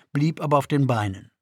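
ordinary delivery, nothing marked

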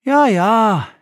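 put-on high, brushed-off boy's "ja, ja"; impatient boy. A narrator's reading. Boy brushing off his father's warning, impatient and high. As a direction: wave off dad's warning like an impatient kid. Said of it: "gab der Junge ungeduldig zurück".

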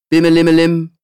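high, loud sing-song ringing tone; ringtone imitation. A narrator's reading. Narrator imitating the incoming call's ringtone, bright and loud. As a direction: chime it like a ringing comm call.

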